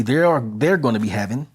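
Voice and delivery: ordinary talk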